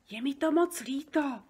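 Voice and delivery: usual speaking voice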